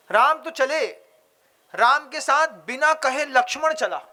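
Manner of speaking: ordinary delivery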